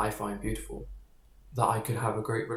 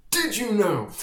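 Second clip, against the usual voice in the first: put-on much higher and louder, an eager know-it-all kid; annoying kid voice; 9 semitones higher, 6 dB louder, slower than usual. Boy telling his friends a science fact, high and eager. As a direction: pipe up like a kid bursting with a fact. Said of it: "I'd sit down and be like".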